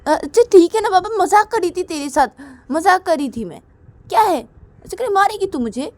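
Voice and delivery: high, loud soothing protest; big sister backing off, high and loud; placating big sister. Acted. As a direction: talk her down. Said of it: "Durga बोलती कि".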